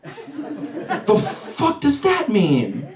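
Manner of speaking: high-pitched, drawn-out bewilderment; baffled. Retold or imitated. Comic replying to brother's odd outburst, baffled. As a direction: act out incredulous confusion.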